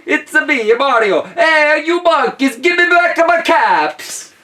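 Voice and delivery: high, loud cartoon Italian plumber; Mario voice. Reader hears the peddler as Mario, high Italian shout. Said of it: "I hear it in a Mario voice".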